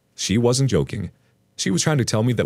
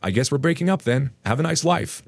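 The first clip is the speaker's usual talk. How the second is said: higher-pitched mock farewell; sarcastic; 7 semitones higher than usual. A narrator's reading. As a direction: play along with light, obvious sarcasm. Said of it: "Again, with obvious sarcasm, I say".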